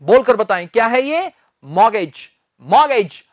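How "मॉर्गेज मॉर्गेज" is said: मॉर्गेज said as mor-gej, no t sound; right pronunciation. Shown as a demonstration. Teacher correcting students, says मॉर्गेज without the t. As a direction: say मॉर्गेज with the t dropped. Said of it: "इसमें हम टी नहीं बोलते".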